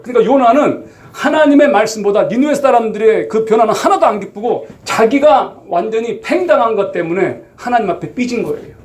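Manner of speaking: ordinary delivery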